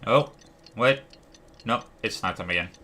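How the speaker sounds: normal talking voice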